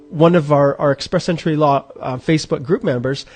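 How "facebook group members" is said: normal talking voice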